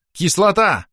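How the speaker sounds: high, sharp order; commanding. A narrator's reading. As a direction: call out a battle command. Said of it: "приказала чёрная баронесса". (приказной выкрик)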